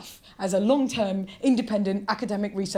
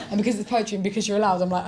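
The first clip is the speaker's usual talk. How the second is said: normal talking voice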